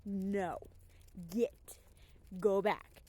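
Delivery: stern commands to a strange dog; a touch of a dog trainer's voice; firm voice. Shown as a demonstration. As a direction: push it away with a firm "go back". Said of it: "a firm voice and be like".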